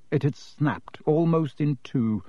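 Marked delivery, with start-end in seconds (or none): none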